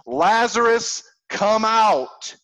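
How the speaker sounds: high, loud ringing call into the tomb; loud shout. Acted. Jesus calls Lazarus out of the tomb with a loud shout. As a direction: call the dead man out at full voice. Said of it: "he shouted with a loud voice".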